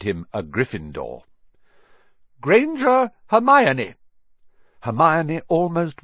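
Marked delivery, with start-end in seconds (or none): 2.4-3.9 high, slow, loud name call; drawn-out, projected register call; roll call. A narrator's reading. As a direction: call the name out across a hall. Teacher calls the roll.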